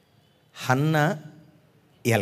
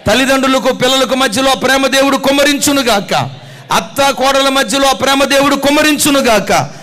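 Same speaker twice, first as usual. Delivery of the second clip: loud, high, ringing blessing; preaching at full force; 8 semitones higher, 9 dB louder than usual. Acted. Preacher blessing the congregation's families, loud and soaring. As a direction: pour the blessing out over the crowd at full volume.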